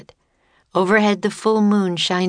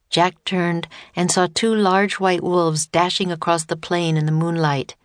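usual speaking voice

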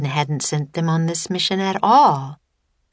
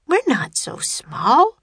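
put-on slow, high little girl voice; young girl; 9 semitones higher than usual. A narrator's reading. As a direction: protest like a small child. Narrator voices young Annie.

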